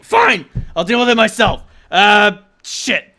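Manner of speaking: angry boss yelling into a radio; furious rant. Acted. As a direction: chew out the absent allies. John yelling into communicator.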